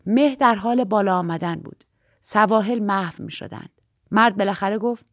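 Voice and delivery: plain reading voice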